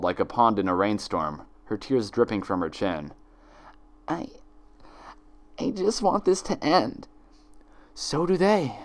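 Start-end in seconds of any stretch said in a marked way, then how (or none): 4.1-7.1 high, broken pony voice; high tearful mare; despairing Twilight. Acted. 7.9-8.8 high, sweetly poisonous twin voice; high sly double coaxing. Acted. Twin answers coldly.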